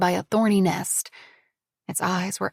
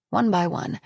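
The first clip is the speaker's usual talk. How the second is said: plain reading voice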